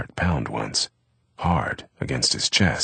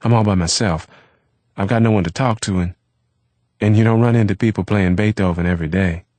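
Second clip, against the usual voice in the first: quick, slightly raised rush of words; fast, a little louder, nervous invitation; said quickly; 3 semitones higher, 5 dB louder, faster than usual. Acted. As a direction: hurry it out, eager not to be refused. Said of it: "He said quickly".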